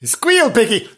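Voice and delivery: mock hillbilly threat, pitched high; hammed-up film villain line; Deliverance quote.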